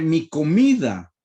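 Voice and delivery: "mi comida" drawn out slowly, each syllable weighed; slow emphasis. Shown as a demonstration. Preacher repeating the verse for the congregation, slow and deliberate. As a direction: slowly lean on "mi comida" to make them notice.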